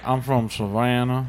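slow mock Savannah drawl; Southern accent. Host teasing guest about his accent role, slow Southern drawl. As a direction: stretch it out in a put-on Southern accent.

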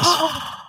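high, hammed-up gasp; big theatrical intake of breath; mock shocked gasp. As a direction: gasp in exaggerated amazement. Mock shock at another pair.

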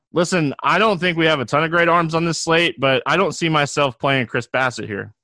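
ordinary talk, nothing marked

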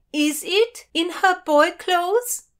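high, loud, stretched yell; yelled question. A narrator's reading. Friend on deck puzzles at heroine in disguise, yelling. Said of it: "yelled Doradai".

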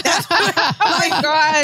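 high, drawn-out laughing gasp. Real, not acted. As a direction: squeal it out, cracking up. Laughing shock.

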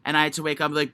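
usual speaking voice

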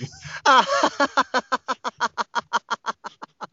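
long high burst of laughter; helpless laughter. Real, not acted. Actor laughing at praise of his British accent, helpless laughter. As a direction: laugh in a rapid, high-pitched run.

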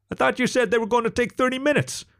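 very high panicked yelp. A narrator's reading. Fox caught out by early arrival, yelping. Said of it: "yelped Alex".

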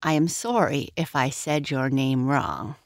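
ordinary delivery

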